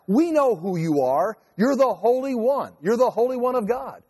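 a hint of demons crying out. Demons in the synagogue call out to Jesus, lightly voiced. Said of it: "the demons cried out".

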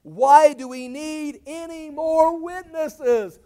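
high, loud, very slow demand; playing outraged. High priest addressing the court, theatrical outrage. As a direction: stretch it out as a public appeal. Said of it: "he's just playing out the role".

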